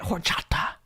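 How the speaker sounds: horchata in a showy Latin accent; Latin flair. Shown as a demonstration. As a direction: give horchata its Latin pronunciation. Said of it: "say it with a Latin flair".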